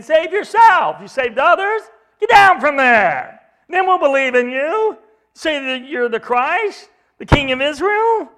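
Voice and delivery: mocking taunts. Leaders hurling insults at Jesus, high loud jeering. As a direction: shout the taunts up at him. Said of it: "They're mocking him".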